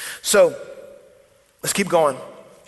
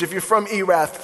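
ordinary talk

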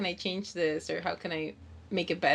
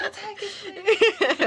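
much higher, laughing squeal of delight; Finding Nemo starfish; 12 semitones higher, 3 dB louder, slower than usual. Retold or imitated. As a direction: squeal it like an overjoyed cartoon starfish. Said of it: "scene from Finding Nemo where the star's like".

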